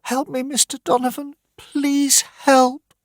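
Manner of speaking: high, pleading woman's voice; high frightened mother pleading; desperate mother begging. Acted. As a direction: beg him for help for her children. Mrs Dawson begging.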